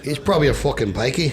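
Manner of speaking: thick put-on Irish accent; mock an Irishman, lilting up at the end. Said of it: "he sounds like that".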